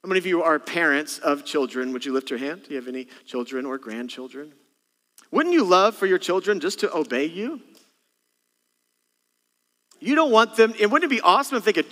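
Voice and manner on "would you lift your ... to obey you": normal talking voice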